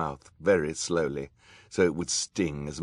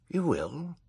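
high, surprised query; puzzled question; 12 semitones higher than usual. A narrator's reading. Villain Olaf surprised by the boy's offer, puzzled high question. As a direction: ask in disbelief, pitch lifted. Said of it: "seemed a little puzzled".

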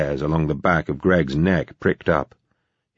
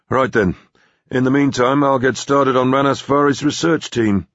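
brisk, higher-pitched and businesslike; 8 semitones higher, 3 dB louder than usual. Acted. Gregg announcing his next job, brisk. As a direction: get straight down to business. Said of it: "Gregg said briskly".

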